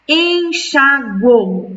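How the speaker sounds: "enxagou" em sílabas lentas, en-xa-gou; syllable split. Shown as a demonstration. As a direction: separe "enxagou" devagar, sílaba por sílaba. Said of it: "a palavra". ("enxagou" escandido devagar)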